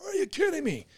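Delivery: high, soft incredulous squeal; mock disbelief. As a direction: replay your disbelief high and small. Retelling disbelief at smashed window.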